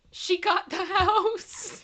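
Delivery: giggling through the words; slow, amused, laughing read. Real, not acted. Reads a funny boat name.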